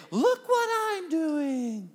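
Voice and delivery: high, drawn-out show-off call; mock attention-seeking. Hypocrites showing off their giving, high drawn-out mock boast. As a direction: stretch it out high like a trumpeted boast. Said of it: "They're trying to get attention".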